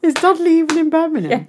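high-pitched attempt at Brummie; Birmingham accent. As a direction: question in a put-on Birmingham accent. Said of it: "I'm from Birmingham".

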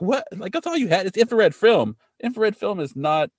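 high-pitched disbelieving reaction; incredulous. Retold or imitated. Podcast host reacting to a character's film excuse, incredulous. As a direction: react in baffled disbelief, voice jumping up.

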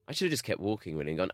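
normal talking voice

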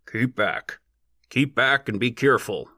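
understated bellow in a put-on voice. A narrator's reading. Bearded digger warning the crowd back, mild bellow. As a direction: bellow a warning, with some restraint. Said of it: "he bellowed".

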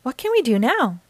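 a touch of excitement; excited cry. A narrator's reading. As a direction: ask like a keen child. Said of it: "cried Peppa".

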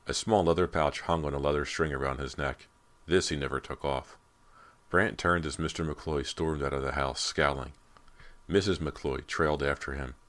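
ordinary delivery

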